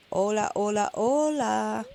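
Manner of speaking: lightly put-on accent for Willy, hola three times; a vague, forgotten accent, only slightly done; Willy's accent attempt. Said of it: "I've forgotten Willie's accent".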